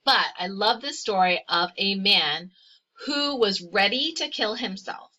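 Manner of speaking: ordinary delivery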